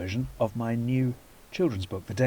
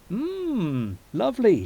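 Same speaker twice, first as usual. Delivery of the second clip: polite Lana; 8 semitones higher, 4 dB louder, much slower than usual. Acted. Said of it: "she offered".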